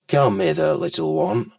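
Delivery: very low crocodile voice; deep, coaxing reptile; crafty crocodile. Acted. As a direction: drop right down low to lure the little one. Crocodile coaxing the child closer.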